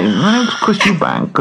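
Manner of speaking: slow lisping celebrity voice; Chris Eubank impression. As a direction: put on a boxer's lisp. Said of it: "sort of has a lisp".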